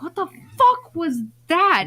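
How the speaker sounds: high, drawn-out mock disbelief; startled bafflement. Parent retelling hearing a strange squawk, baffled outcry.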